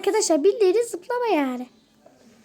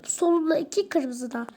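hushed, breathy aside; whispering; 4 semitones lower, 6 dB quieter than usual. Real, not acted. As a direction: whisper it to yourself. Aside while playing.

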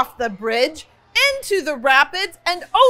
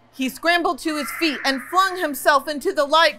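ordinary delivery, nothing marked

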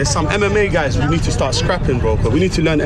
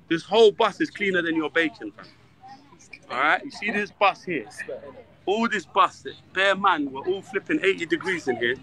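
hushed on the bus; 7 dB quieter than usual. Real, not acted. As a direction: keep it down, scolding under your breath. Talking quietly on packed bus.

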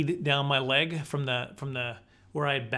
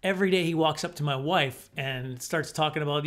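ordinary delivery

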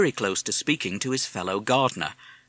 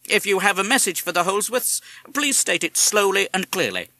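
imitating Mr Holsworth; 8 semitones higher, 4 dB louder than usual. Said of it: "answered in his own voice".

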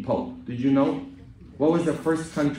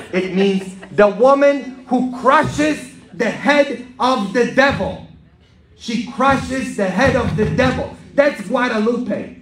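loud, high, triumphant proclamation; full-force preaching; 10 semitones higher, 8 dB louder, slower than usual. Acted. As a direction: proclaim the meaning like a victory from the pulpit.

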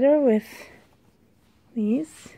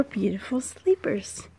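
whispering; 2 semitones lower, 7 dB quieter than usual. Real, not acted. Quiet aside while sorting.

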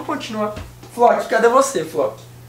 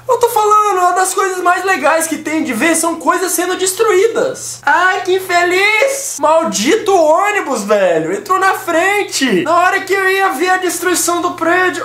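indignado; 6 semitones higher than usual. Real, not acted. Reclamando irritado do ônibus.

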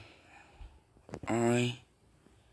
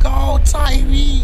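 much higher, much louder, slow yell; cheering yell; 14 semitones higher, 11 dB louder, slower than usual. Real, not acted. Gamer urging Tyreek toward the end zone, long cheering yell. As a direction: scream him all the way down the field.

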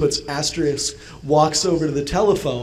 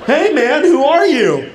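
high, mock-startled challenge to an intruder; hammed-up homeowner alarm; 8 semitones higher, 5 dB louder than usual. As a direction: challenge the stranger in a raised pitch. Acting out confronting an intruder.

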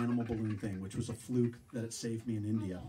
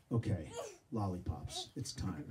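low, hushed self-talk; quiet resolve; 5 semitones lower, 6 dB quieter than usual. Acted. Man to himself before reaching for lollipops, low resolve. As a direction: drop low and soft, as if steeling yourself.

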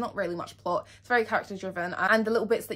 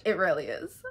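ordinary talk, nothing marked

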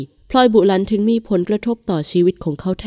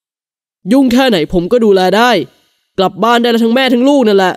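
louder, slow, commanding; bossy husband; 3 semitones higher, 6 dB louder than usual. A narrator's reading. Husband ordering his wife and child home: louder, slow command. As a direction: lay down the law, louder and slower.

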